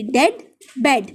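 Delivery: डेड with the short "e" of बेड; rhyming pronunciation. Shown as a demonstration. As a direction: say डेड, बेड as a spaced rhyme. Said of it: "sound है वो किसके जैसी है, बेड जैसी". (डेड को बेड जैसी छोटी "ए" से बोलें)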